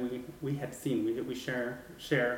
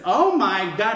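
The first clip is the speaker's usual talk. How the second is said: much higher and louder, gasping; shocked exclamation; 9 semitones higher, 11 dB louder, slower than usual. Retold or imitated. Woman hearing her sister describe the same ghost, shocked. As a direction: burst out in shock. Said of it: "And I said".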